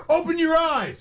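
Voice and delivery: high-pitched ballpark holler; mock umpire heckle. Host playing a fan heckling the umpire, high mock yell. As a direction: heckle like a fan who thinks it's original. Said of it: "I'm gonna tell this umpire".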